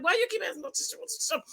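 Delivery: snapping back. Retold or imitated. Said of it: "I'm like".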